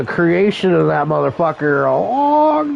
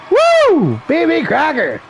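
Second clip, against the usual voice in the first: rowdy party animal; 7 semitones higher, slower than usual. Real, not acted. Cheering wrestler's name.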